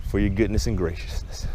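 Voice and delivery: low, soft thanks to the Lord; quiet thankful prayer. Real, not acted. Gives thanks in a short prayer.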